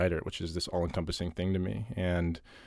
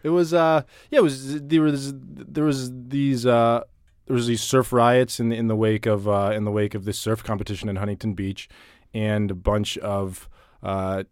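newscaster voice; 3 semitones higher, 7 dB louder than usual. Acted. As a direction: read it like the evening news. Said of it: "You just, like, slipped into your, like, uh, newscaster voice".

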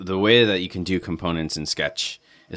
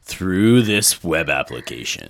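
slow, dramatic trailer narrator; movie trailer voice. Pretend. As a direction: drag it out like a film trailer. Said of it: "do the movie voice".

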